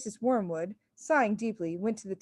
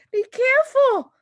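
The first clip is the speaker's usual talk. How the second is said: piercing shriek, much higher and louder; 10 dB louder than usual. A narrator's reading. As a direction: shriek a warning. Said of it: "shrieked the mother".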